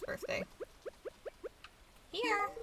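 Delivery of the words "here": excited. Pretend. Handing over the gift.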